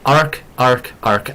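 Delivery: "arc" said three times in an Irish accent; Irish "arc", repeated like a bark. Shown as a demonstration. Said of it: "I'm gonna say it three times".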